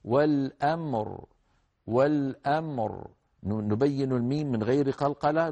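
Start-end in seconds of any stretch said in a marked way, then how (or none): 0.0-3.1 والأمر slow, clear ميم with no echo, heavy still راء; recitation demo. Shown as a demonstration. Tajweed teacher shows students the stop on والأمر. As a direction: say والأمر slowly, stopping on the راء. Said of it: "هكذا".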